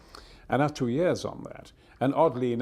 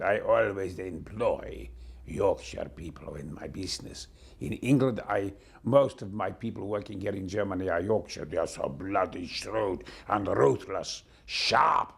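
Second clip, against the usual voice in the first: put-on low, slow German-accented English; ponderous mock-German accent, every word weighed; German banker accent; 5 semitones lower than usual. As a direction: imitate a deliberate German banker. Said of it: "I met the head of the Bundesbank".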